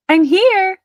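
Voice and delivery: high, loud arrival call; shouted. A narrator's reading. Pearl announcing herself to the family, loud. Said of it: "she shouted".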